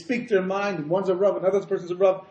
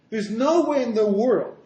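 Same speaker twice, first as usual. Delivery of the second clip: "no way in the world" louder, higher, slow; emphatic; 6 semitones higher, 6 dB louder, slower than usual. Real, not acted. Teacher insisting on the point, emphatic. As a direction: drive home "no way" with raised, drawn-out force.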